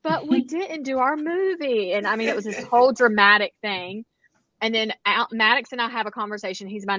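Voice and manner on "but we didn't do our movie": put-on high, plaintive kid whine; mock-tearful complaint to mom; imitating crying child. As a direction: whine it slowly like a heartbroken child. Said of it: "crying. He was so sad".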